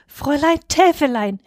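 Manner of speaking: high, enthusiastic exclamation; delighted cry. A narrator's reading. Husband thrilled that she accepts, delighted. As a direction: cry out her name with enthusiasm. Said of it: "rief er begeistert".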